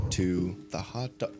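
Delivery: normal talking voice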